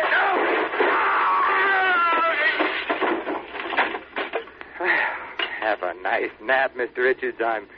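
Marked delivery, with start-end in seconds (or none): 0.0-0.6 panicked high scream; terrified shout. Acted. Attacked with the chandelier. 0.6-5.5 pained scream. Acted. Knocked out by falling chandelier.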